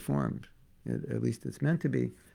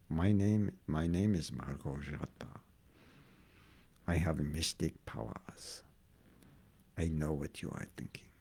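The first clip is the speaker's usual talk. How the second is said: hint of a spooky fortune-teller; introducing the wife as a mock mystic, low and hushed; mock mystic woman; 9 semitones lower, 8 dB quieter than usual. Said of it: "I can say his wife's name".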